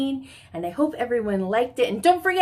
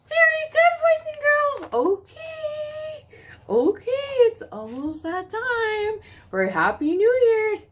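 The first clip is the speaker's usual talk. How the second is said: much higher, bright praise; excited praise; 11 semitones higher, slower than usual. Real, not acted.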